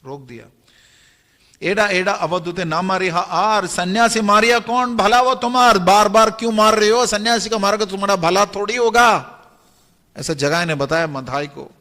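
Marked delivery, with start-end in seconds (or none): 1.6-9.4 raised, louder pleading rebuke; urgent remonstrance. Acted. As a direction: protest to stop a brother striking a monk. Jagai scolds Madhai for hitting. (ऊँचे स्वर में फटकार)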